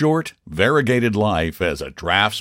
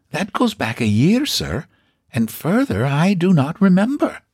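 high, prim landlady voice; a proud, chatty housekeeper; old landlady voice; 10 semitones higher than usual. Acted. Housekeeper answers.

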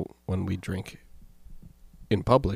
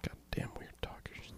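whispered grumble; 20 dB quieter, slower than usual. Pretend. Echoing mock grumble.

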